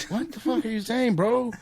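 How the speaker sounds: high-pitched, acted incredulous outburst; mock-exasperated yelp; exasperated disbelief. Exasperated listener reaction acted out.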